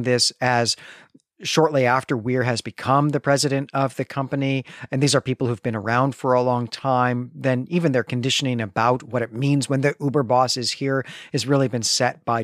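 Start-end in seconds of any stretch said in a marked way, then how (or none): none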